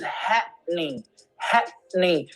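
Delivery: "happening" as "hat-nin", throat closed in the middle; glottal pause. Shown as a demonstration. Teacher isolating the pronunciation, with a clear glottal pause. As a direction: stop the breath mid-word in "happening". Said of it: "There is a pause. There's a glottal pause".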